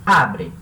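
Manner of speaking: "Abre" dito isolado e mais grave, A tônico; dá o modelo "Abre" em tom baixo, AB forte; clear model word. Shown as a demonstration. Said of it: "então o verbo mesmo é abre". (pronúncia modelo de "Abre")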